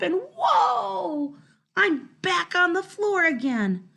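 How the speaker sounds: high, loud startled cry; startled whoa. A narrator's reading. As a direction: yelp as you slip. Dog slips and falls.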